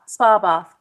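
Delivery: ordinary delivery, nothing marked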